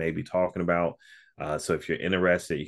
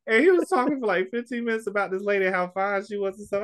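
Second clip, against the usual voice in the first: excited laughing retell. Real, not acted.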